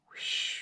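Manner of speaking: soft breathy whoosh; whooshing wind. Acted. Reader voicing wind taking the bow, breathy. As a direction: blow it away softly.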